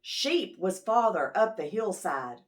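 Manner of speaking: plain reading voice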